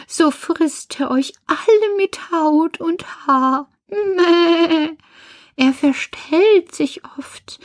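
high, slow goat voice; bleating mother goat. Acted. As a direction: bleat out a drawn-out warning as an old goat. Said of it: "Da meckerte auch die Alte". (hohe, meckernde Geißenmutter)

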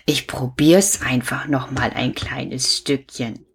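usual speaking voice

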